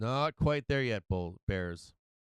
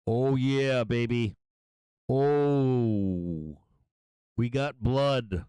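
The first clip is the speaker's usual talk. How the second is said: louder, gleeful trading cheer; excited; 5 dB louder, much slower than usual. Real, not acted. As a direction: celebrate the drop out loud. Price drops, trader celebrates.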